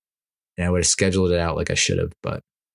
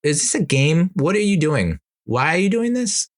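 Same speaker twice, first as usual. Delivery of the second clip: put-on higher, puzzled little girl voice; a lightly voiced curious young daughter; imitating daughter; 9 semitones higher than usual. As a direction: ask like a confused kid. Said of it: "My daughter was like".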